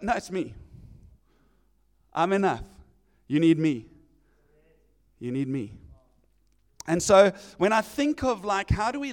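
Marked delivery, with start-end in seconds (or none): 0.0-5.7 tender Jesus. Retold or imitated. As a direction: gently insist he's enough. Jesus answers, lower, softer.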